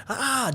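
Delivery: high hammed-up scream; mock scream. Maria jumps up from the flames with a high mock scream. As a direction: yelp it out like the woman jumping up. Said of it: "She jumps up screaming".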